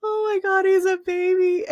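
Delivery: high squeal, stretched at the end; retold astonishment. Onlooker learning the dog's age, high astonished squeal. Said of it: "he's like".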